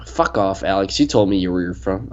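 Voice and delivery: ordinary talk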